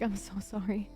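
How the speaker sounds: usual speaking voice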